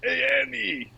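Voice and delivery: put-on high-pitched New York-accented creature voice; Watto. Alien from Star Wars calling Andy, high New York-accented voice. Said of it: "I picture Watto from Star Wars Episode One".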